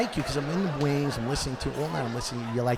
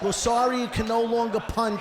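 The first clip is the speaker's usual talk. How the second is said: higher, louder mock retort; mock shout; 9 semitones higher, 3 dB louder than usual. Comic shouting a retort at the footless fan, higher and louder. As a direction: throw it back loud and high.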